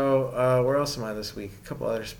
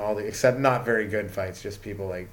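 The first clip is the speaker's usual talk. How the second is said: usual speaking voice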